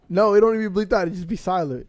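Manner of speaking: normal talking voice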